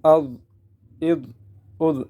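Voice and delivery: heavy closing dh; full-mouthed zaa. Shown as a demonstration. Teacher drills thick letter, making the dh heavy. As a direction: thicken each dh, raising the tongue. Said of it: "پر کرتے ہوئے".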